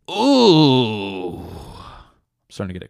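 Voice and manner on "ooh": loud ooh of bliss; pleasure moan. Acted. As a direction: moan with delight.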